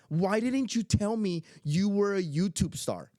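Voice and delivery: excited granddaughter. Retold or imitated. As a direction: imitate a thrilled child demanding an answer. Granddaughter's excited demand, retold.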